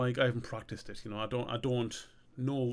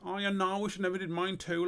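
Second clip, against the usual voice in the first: high, hurried character voice; Glenn; 7 semitones higher than usual. Acted. Character regretting it to his mate, high and hurried. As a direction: raise the pitch and rush the regret. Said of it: "And then Glenn's like".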